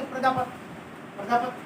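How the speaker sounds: "प्रजापति" with a clipped, barely heard final short i; imitating UP pronunciation. Teacher mimicking how people in UP say it, short final vowel. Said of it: "वहां पर जाकर बोलते".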